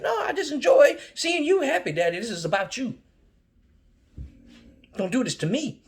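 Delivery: put-on a child begging dad; desperate child plea. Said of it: "I'm like".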